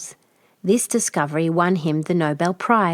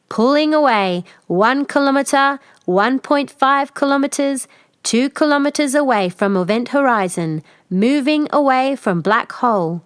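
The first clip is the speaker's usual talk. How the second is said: high, louder computer announcement; steady machine report; ship computer; 6 semitones higher, 3 dB louder than usual. Acted.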